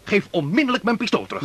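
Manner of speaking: commanding. Acted. Officer demands pistol back.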